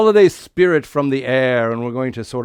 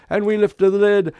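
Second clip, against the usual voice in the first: put-on kids party magician; 6 semitones higher, 4 dB louder than usual. Santa performs a magic pan trick.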